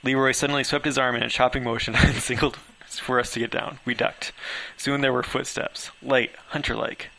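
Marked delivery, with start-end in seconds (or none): none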